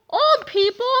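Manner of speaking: high, loud offended exclamation; indignant cry. A narrator's reading. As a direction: protest being called old. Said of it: "cried old George".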